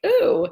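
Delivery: high, loud disgusted ooh; daughter's grossed-out ooh. Retold or imitated. Daughter reacting after spitting something up, a high squeal. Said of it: "be like".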